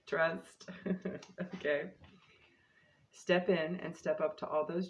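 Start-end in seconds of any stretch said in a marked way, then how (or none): 0.7-1.5 short laugh. Real, not acted. Laughs after "Trust.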